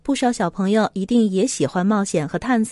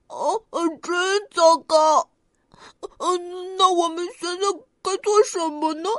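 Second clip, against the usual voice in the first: worried little bear; 7 semitones higher than usual. Acted. Worried bear cub Xiaopang.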